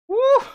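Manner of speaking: whooping cheer, high and loud; very high woo. Real, not acted. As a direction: let out a whoop of excitement. Celebrating a near chance.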